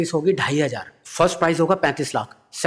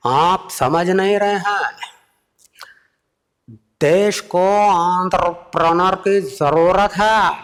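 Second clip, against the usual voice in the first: slow, higher, emphatic orator voice; exasperated Modi; 6 semitones higher, 4 dB louder, slower than usual. Retold or imitated. Modi exasperated, explaining what the country needs. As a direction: plead the nation's need with mock exasperation. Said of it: "Modi जी genuinely परेशान".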